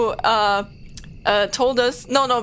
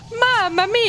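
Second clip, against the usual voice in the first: high exaggerated Italian catchphrase; Mario; 12 semitones higher, 3 dB louder than usual. Showing how an Italian accent sounds, as Mario. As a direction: sing it out like a cartoon Italian. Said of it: "like how Mario sounds like".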